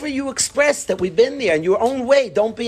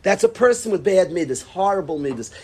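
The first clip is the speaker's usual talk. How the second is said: ordinary talk, nothing marked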